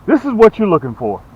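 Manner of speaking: usual speaking voice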